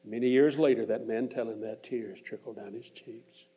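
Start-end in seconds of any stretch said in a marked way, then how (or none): none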